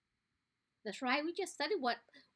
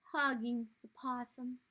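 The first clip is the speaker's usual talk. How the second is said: plain reading voice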